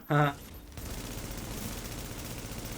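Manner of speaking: airy burst of laughter; breathy laugh. Real, not acted. Laughs after kill.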